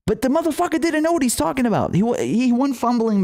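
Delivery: incredulous high-pitched rant; exasperated outburst. Real, not acted. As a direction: let the frustration spike up high. Exasperated rant about Mueller.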